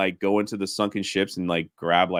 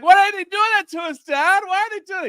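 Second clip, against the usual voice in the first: much higher, mock-tearful child pleading with his dad; crying kid; 6 dB louder than usual. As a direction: whine like a kid about to cry. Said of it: "like, screaming and, like, almost crying".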